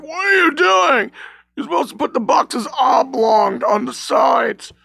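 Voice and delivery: high-pitched yelling boss; retold yell. Coworker Tim yelling at him over boxes, high shout. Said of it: "He yelled at me like".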